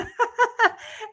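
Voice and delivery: high, loud laugh; burst of laughter. Real, not acted. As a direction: break into a quick laugh. Laughs mid sentence.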